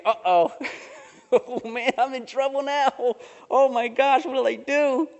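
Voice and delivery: high, frightened inner voice, caught out; Sarah realizing she's been caught, voiced high and panicked. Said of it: "She's thinking".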